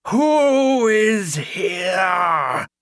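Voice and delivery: high, loud, slow wailing ghost; ghostly cry. A narrator's reading. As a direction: wail it out like a storm at the door. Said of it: "she cried".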